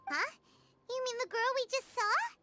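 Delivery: very high, squeaky surprise; startled question. Acted. Companion reacting to the name of his first work, startled. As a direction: blurt a puzzled, high-pitched question.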